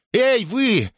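high-pitched indignant challenge; outraged housekeeper's shrieky "hey, you"; lashing out. A narrator's reading. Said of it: "накинулась на Сирену домоправительница".

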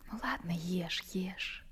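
soft unvoiced urging to eat; hushed coaxing. [name] whispers to Vanyushka to eat. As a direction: whisper it gently, like feeding him.

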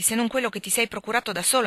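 ordinary delivery, nothing marked

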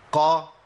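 "قا" said with an open a after a heavy qaf; qaf with fatha. Shown as a demonstration. Teacher showing learners the fatha qaf, clearly. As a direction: open "قا" fully. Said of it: "القاف المفتوحة".

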